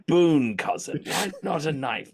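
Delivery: hammed-up sneering villain explaining; slow mock-petulant scolding of a cousin; sheriff's mock reply.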